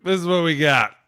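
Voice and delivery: loud, slow, high mock referee announcement; ref on the mic. Mocking the ref's big mic announcement, slow and loud. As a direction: ham up a ref calling a penalty on the stadium mic. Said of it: "The way he gets on there".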